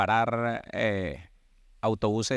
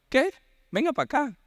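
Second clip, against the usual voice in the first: father calling out; 13 semitones higher than usual. Retold or imitated. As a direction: bark the summons up high like a stern father. Father summons beaten son.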